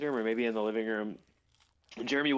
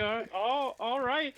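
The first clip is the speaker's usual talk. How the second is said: high, deflated stammer, imitating; mock sad; 10 semitones higher, slower than usual. Friend imitates the kicked-out guy giving up, high and hammed-up sad. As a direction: let it sag like a dejected stranger. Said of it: "He looks super sad".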